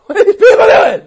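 high, loud jeering call; mocking shout. Townspeople jeering at Israelites circling Jericho, loud. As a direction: shout it like scoffers at the walls. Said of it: "People are like".

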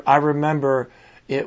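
ordinary talk, nothing marked

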